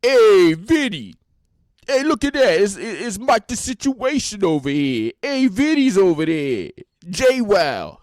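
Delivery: loud, high Jersey wise guy voice; shouted mock wise guy; wise guy impression. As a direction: ham up a Jersey Shore tough guy calling out. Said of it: "Everybody's just full wise guy".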